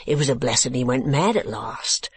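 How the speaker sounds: slow, emphatic working-man's voice; keeper, condemning. Acted. Keeper telling Kate of madman's cruelty, slowly condemning. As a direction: draw out each misdeed in rough indignation.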